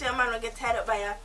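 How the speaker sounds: ordinary delivery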